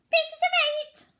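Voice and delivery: very high, loud parrot squawk. A narrator's reading. Parrots chattering on deck, high squawking parrot. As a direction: screech it out like a parrot. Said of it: "Other parrots could say".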